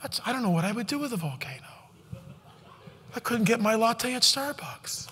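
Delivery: mock a pampered latte drinker; hushed sneering imitation of a complainer; mocking snowflake. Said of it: "the snowflakes don't wanna hear it".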